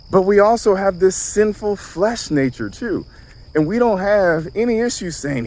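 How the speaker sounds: usual speaking voice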